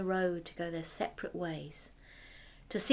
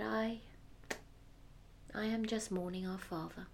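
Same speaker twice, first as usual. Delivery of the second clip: quiet and sad; 11 dB quieter than usual. Acted. Quiet youngest daughter voiced.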